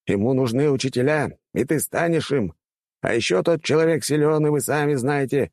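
high-pitched, eager rector; persuasive old rector. Acted. Rector talking students into joining the man, persuasive.

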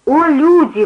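high, loud appeal to the crowd; imploring preacher. A narrator's reading. Preacher to a crowd, hands pressed to her chest, imploring. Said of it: "продолжала она, прижимая к груди руки".

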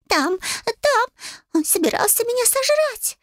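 very high, squealing creature voice; panicked owl familiar. A narrator's reading. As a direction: squeal out the complaint in terror. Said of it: "с огромными от ужаса глазами".

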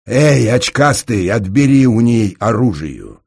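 loud, high, drawn-out command; barked order. A narrator's reading. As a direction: order an underling from across the room. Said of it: "приказал городовой". (громкий властный приказ)

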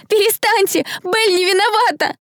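very high, desperate pleading shout; anguished tearful cry. A narrator's reading. Girl defending accused friend to crowd, anguished cry. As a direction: scream it through tears. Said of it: "надрывно закричала Дэрил сквозь слёзы".

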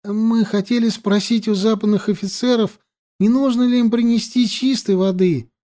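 high, servile pleading whine; convincing whimper. A narrator's reading. Water carrier asking to serve the officers, whimpering. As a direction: whine the request pitifully, high-pitched. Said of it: "Правдоподобно захныкал Живан".

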